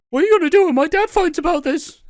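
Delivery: high, fast, loud bratty whine; whiny kid voice. Retold or imitated. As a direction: whine like a spoiled child. Voicing a whiny character.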